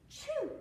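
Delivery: big gasp, then a high, loud "choo"; mimed sneeze. A narrator's reading. Teller sneezing as the bee with a cold, mimed high sneeze. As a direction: act out a bee's sneeze. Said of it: "he sneezed".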